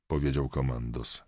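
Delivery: plain reading voice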